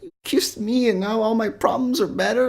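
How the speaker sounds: high-pitched mocking kid voice; high, childish mock pleading; little kid impression. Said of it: "He's like a fucking little kid".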